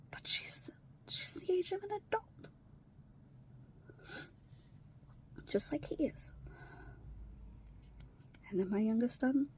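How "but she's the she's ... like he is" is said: soft, high whisper; whispered despair. Real, not acted. Lamenting that the stepdaughter is an adult, whispered. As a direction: barely voice it, despairing. Sad aside about stepchildren.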